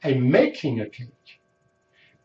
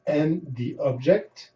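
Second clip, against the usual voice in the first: normal talking voice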